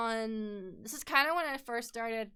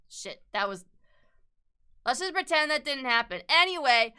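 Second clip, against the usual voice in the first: normal talking voice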